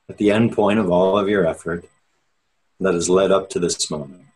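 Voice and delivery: measured, unhurried meditation guide with long pauses; steady, spacious meditation-teacher delivery; guided meditation. Real, not acted. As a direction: ease listeners inward, leaving space after each phrase. Leads an opening meditation.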